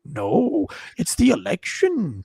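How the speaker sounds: swooping, exaggerated self-correction; mock correcting. Host correcting his own slip, hammed-up sing-song. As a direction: stretch and swoop the correction comically.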